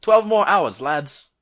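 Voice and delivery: high, full-voiced yell. Guard to punished lads outside, yelling out. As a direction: bellow it out to the men outside. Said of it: "and yells out".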